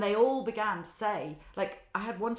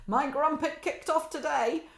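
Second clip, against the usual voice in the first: playful family saying; 8 semitones higher, 4 dB louder, slower than usual. Retold or imitated. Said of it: "what I will say is like".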